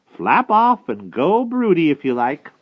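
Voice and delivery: loud, swooping, slow dismissal; witch's cry. A narrator's reading. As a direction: send them packing in a witch voice. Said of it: "cried Madam Mim".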